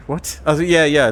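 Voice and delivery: ordinary talk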